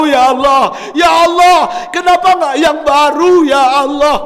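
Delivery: from a plea building to a high, loud shout; a dying man wailing his regret to God; crying out to God. Retold or imitated. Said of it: "Suamiku menangis".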